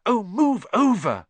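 high, loud, impatient snarl. Acted. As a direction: shove them aside with your voice. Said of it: "Hermione snarled".

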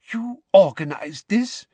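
high, loud disbelieving question; incredulous. A narrator's reading. Minister questioning the headmaster, incredulous.

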